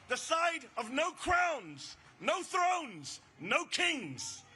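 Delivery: slow, punched slogan cadence; slow rally cadence. Real, not acted. Rally speaker chants slogans to the crowd, slow and punched. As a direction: hit each phrase slowly for the crowd.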